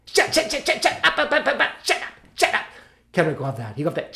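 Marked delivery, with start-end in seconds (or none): 0.1-2.6 high stuttering staccato "shut up" routine; comic annoyance. Acted. Actor shutting up his sidekick, comic stuttered irritation. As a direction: stammer it in rapid high bursts. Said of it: "getting annoyed with him".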